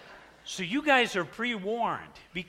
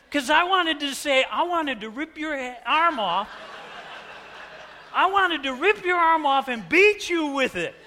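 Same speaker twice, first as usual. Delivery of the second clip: much higher and louder, laughing; half-laughing outburst; 9 semitones higher, 7 dB louder than usual. Real, not acted. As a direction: blurt out the violent wish laughing. Confessing rage to congregation.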